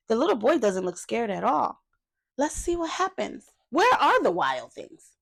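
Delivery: plain reading voice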